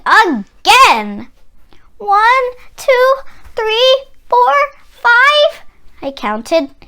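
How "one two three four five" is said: numbers climb much higher, louder; rising anxious count. A narrator's reading. Child counting seconds to thunder, pitch climbing high.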